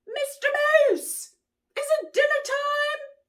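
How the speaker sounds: high squeaky little rabbit; small rabbit voice. Acted. Rabbit asking Mister Moose about dinner, high and squeaky. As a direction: pipe up like a tiny bunny.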